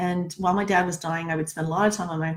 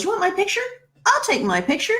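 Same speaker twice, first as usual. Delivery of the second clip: lightly voiced busker, pitched up high; imitated brash busker; 11 semitones higher than usual. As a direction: mimic a busker's challenge in a higher voice. Busker taunts while grabbing camera.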